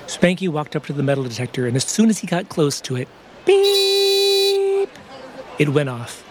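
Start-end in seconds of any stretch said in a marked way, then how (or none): 3.5-4.9 imitated beep. Metal detector goes off.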